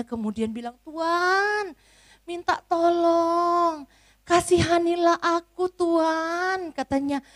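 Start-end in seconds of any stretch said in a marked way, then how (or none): 0.8-6.7 higher, slow begging voice; pleading servant. Acted. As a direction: beg the master for mercy, drawn out. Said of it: "bersujud kepada tuannya".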